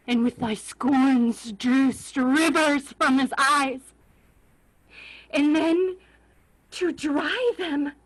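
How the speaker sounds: angry tirade breaking into a cry; rising fury. Acted. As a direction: hurl the charge at her. Richard accuses Margaret of murder.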